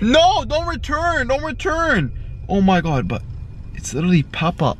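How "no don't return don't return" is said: high, drawn-out "no" of protest; alarmed protest. Real, not acted. Pilot protesting as the drone heads home, alarmed. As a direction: plead in a raised pitch.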